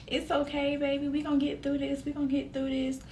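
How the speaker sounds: gently calm a crying partner, retold; soothing reassurance.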